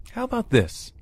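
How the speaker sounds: high, light, thoughtful proposal; musing. A narrator's reading. Captor offering his captive a deal, musing. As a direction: float the idea in a lifted voice. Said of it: "he mused".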